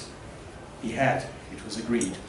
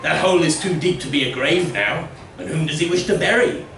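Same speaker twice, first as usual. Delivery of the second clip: higher, louder scornful villager; 4 semitones higher, 5 dB louder than usual. Acted. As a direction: sneer at the digging fool. Villager voice in story.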